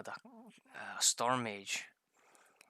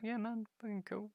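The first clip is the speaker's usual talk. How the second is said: excited squeaky reply; 11 semitones higher than usual. Retold or imitated. Retelling own eager reply.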